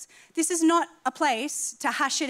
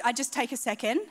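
ordinary talk

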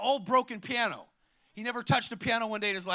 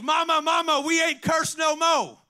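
high, loud call to Mama; excited boy's shout; 5 semitones higher, 4 dB louder than usual. Retold or imitated. Preacher as young Stevie running home to Mama, excited shout. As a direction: burst out higher, drawing out the ending.

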